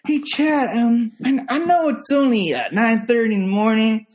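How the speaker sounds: high, hesitant kid impression; imitating wheedling kid. Kid sheepishly approaching his babysitter about lunch, high imitated voice. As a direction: play a kid tentatively working up to a favor. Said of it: "that's how he talks".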